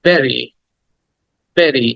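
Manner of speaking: "বেরি" with a short e, beh-ree; correct pronunciation. Shown as a demonstration. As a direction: model "বেরি" crisply for learners, falling at the end. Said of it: "কি বলবে?".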